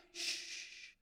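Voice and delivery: long, quiet shushing; soft hush. Acted. Preacher answering the mock shouting, soft drawn-out shush. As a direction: cut the clamour with a hush.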